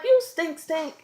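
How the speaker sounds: put-on high-pitched teasing of a toddler; high, playful telling-off; talking to baby. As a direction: reenact baby-directed chiding. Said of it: "And I'm talking to him".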